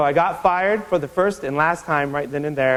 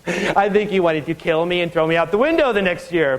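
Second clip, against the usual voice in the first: chuckling through the words; real amused laughter; laughing; 3 semitones higher than usual. Real, not acted. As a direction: laugh while telling it. Laughs recalling his dad.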